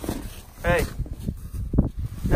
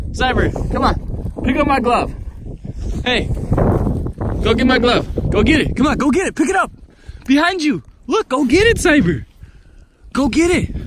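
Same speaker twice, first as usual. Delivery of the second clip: loud urging; 7 semitones higher, 4 dB louder, faster than usual. Real, not acted. Urging dog to fetch glove.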